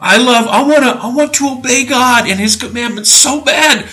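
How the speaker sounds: high-pitched, hammed-up eagerness; mocking zeal. Preacher imitating those who justify sin, mock zealous. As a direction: gush it as a fake-devout believer.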